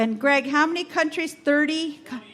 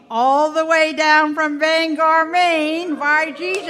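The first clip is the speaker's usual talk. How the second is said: put-on Maine accent, playful and punchy; 4 semitones higher, 5 dB louder, slower than usual. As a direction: lay on a jokey Down East Maine accent. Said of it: "have to talk in a Maine accent".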